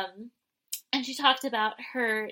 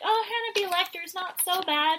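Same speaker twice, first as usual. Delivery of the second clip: higher, put-on mocking voice; lightly put-on higher voice; mock inner voice; 10 semitones higher than usual. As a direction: say it as a grudging fan, high and put-on. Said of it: "And you're like".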